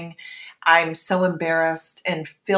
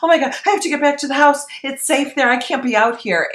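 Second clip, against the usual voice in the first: higher, louder and fast, a rush of panic; retold panic; 8 semitones higher, 6 dB louder, faster than usual. Mother with a screaming baby in the stroller, higher, louder, fast panic. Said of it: "overwhelming feeling of panic".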